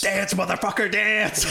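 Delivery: high-pitched hammed-up command; mock yelled order. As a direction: imitate a soldier yelling orders at his victim. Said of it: "telling him to dance".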